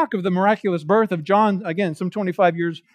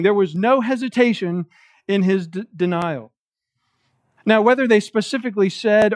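normal talking voice

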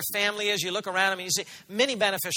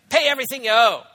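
louder, harsh demand for payment; 4 semitones higher, 9 dB louder than usual. Retold or imitated. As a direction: bark it like a creditor choking a debtor. Servant chokes debtor demanding payment.

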